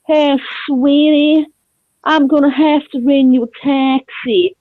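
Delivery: drawn-out Texan drawl, slow to start; Texas accent impression. Imitating the Texan offering to call a taxi, drawled Texas accent. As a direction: put on a Texan visitor's drawl. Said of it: "That's how we used to talk".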